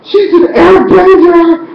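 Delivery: very high, loud squeal of joy; overjoyed fan cheering, slow on the second line; ecstatic cheer. Retold or imitated. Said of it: "I was like".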